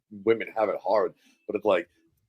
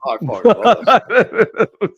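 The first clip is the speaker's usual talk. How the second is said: loud, much higher burst of delight; excited gush; 9 semitones higher, 9 dB louder than usual. Real, not acted. Friend gushing over the song I'm Just Ken, loud and laughing. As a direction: blurt it out, thrilled and laughing.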